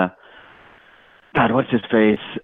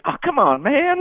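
mock plea rising much higher; whiny little guy; slower than usual. Fan imitating the little guy pleading while being beaten. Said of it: "the little guy being like".

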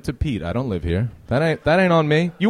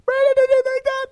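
much higher, squeaky pitch; voice went high. Real, not acted. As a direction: voice jumps up high. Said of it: "My voice went really high".

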